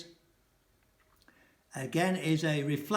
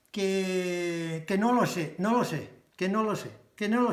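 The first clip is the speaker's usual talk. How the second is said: rattled off, higher and insistent; exasperated; 3 semitones higher than usual. Shown as a demonstration. Teacher acting a flustered Spaniard, exasperated and insistent. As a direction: keep throwing up your hands, que no lo sé. Acted exasperated "I don't know.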